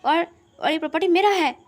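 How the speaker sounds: stammer, then assert ownership forcefully; insistent claim. Acted. Insists the property is his. (ज़ोर देकर, ऊँची आवाज़ में दावा)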